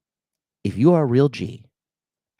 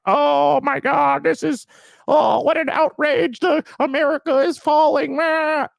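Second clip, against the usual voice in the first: very high, loud mock wail; hammed-up outrage, wailed high; mock crybaby; 4 dB louder than usual. Imitating an outraged man online, mock crybaby wailing. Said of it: "It is the biggest crybaby cope".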